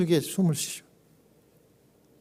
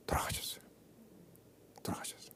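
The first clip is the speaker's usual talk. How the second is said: whispered, very soft; hushed grief; 23 dB quieter, faster than usual. Real, not acted. Pastor reveals that the mother died, whispered. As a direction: let the death land in a whisper.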